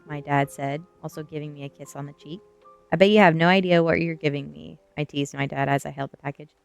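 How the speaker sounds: usual speaking voice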